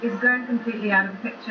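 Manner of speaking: ordinary talk, nothing marked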